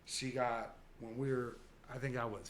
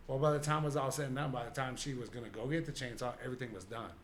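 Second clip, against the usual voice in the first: ordinary talk, nothing marked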